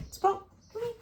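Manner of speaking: high call to a dog; high coaxing call. Real, not acted. Calling the dog.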